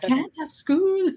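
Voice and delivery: put-on high, drawn-out child's Scottish wail; mock a devastated Scottish kid, stretched out; little Scottish girl. Quoting devastated girl at school time, high Scottish accent. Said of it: "it's the little Scottish girl".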